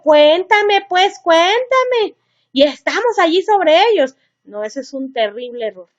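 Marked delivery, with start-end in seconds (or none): 0.0-0.8 pleading parent. Retold or imitated. Parent begging teen to talk. 0.9-2.1 higher, very slow whiny begging; a mock-whiny mom dragging out her request; drawn-out plea. Parent begging teen to talk.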